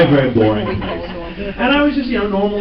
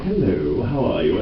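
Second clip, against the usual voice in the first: a lightly voiced, softer British charmer; Sebastian's British accent; 7 semitones lower, 5 dB quieter than usual. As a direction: greet them as a suave Englishman, barely put on. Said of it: "you can do the Sebastian voice".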